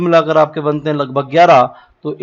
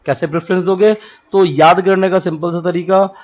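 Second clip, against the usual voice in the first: ordinary delivery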